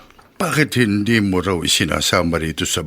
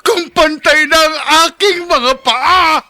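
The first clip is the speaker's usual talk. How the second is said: high, sobbing lament; tearful wail over one's own death; crying lament; 5 dB louder than usual. Acted. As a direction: weep the words out in despair. Teaser of a tragic radio drama.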